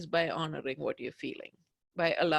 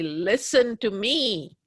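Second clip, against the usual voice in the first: loud, slow, insistent demand to be heard; pleading yell; 4 semitones higher, 5 dB louder, slower than usual. Pretend. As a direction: shout it slowly like you're on their chest. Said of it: "jump up and down on somebody's chest and say".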